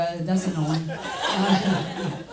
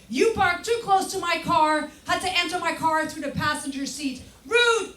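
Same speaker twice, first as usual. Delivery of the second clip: prissy neighbor note; 8 semitones higher than usual. Retold or imitated. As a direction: read it like an offended neighbor.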